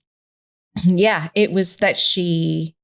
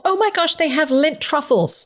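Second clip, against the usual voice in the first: high, delighted mock-kid exclamation; excited child, imitated; 8 semitones higher than usual. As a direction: imitate a daughter thrilled by a candy find. Mother voices her excited daughters.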